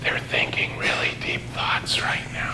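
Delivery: imitating a hushed executive whisper. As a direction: whisper it like the room is sacred.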